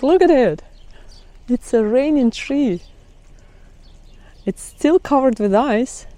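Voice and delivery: ordinary talk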